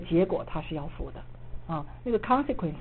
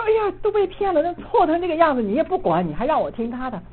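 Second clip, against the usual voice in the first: much higher, louder indignant cry that fades down; indignant outburst; 9 semitones higher, 5 dB louder than usual. Retold or imitated. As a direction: protest hotly at the mentor, then settle. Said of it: "我就很不服气啊". (向导师激动地抗议，声音高而响)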